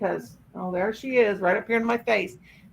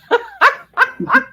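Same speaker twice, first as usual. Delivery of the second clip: loud high-pitched burst of laughter; 9 dB louder than usual. Real, not acted.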